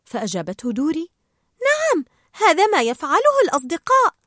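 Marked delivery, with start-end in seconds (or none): 1.7-4.1 high, loud, eager character voice; Dory, eager reassurance. Acted. Dory assuring Marlin they are friends, high and eager. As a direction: answer high with full conviction.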